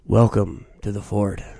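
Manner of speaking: low, slow put-on intro voice; deep put-on voice. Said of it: "he got to change his voice".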